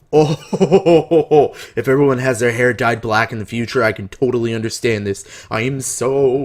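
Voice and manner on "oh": laughing realization. Real, not acted. Excited reaction to plot twist.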